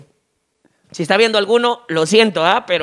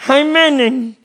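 a hint of a nasal-speech voice, slightly higher; nasal-speech guy; 7 semitones higher, 4 dB louder, much slower than usual. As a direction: mumble it like you can't say your consonants. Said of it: "le dice el gangoso". (voz gangosa leve)

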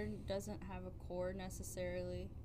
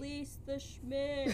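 slow mock decree; 6 semitones higher, slower than usual. Joking decree.

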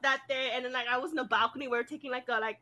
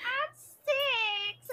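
put-on high-pitched small girl's voice; imitated little girl; 12 semitones higher than usual. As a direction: mimic a six-year-old stating her age.

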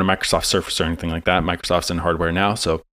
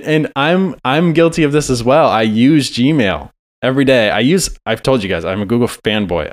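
usual speaking voice

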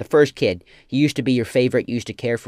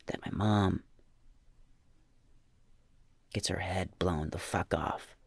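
normal talking voice

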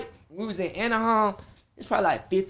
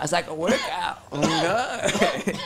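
laughing; much slower than usual. Real, not acted.

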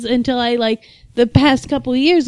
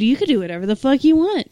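ordinary delivery

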